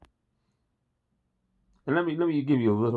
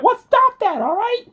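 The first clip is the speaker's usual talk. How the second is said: very high, louder falsetto sing-song; Beverly Hills Cop line; 6 dB louder than usual. Retold or imitated. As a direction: squeak it out like a childish brat blocking his ears. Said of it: "here's my favorite line from Beverly Hills Cop".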